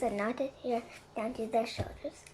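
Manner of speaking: ordinary delivery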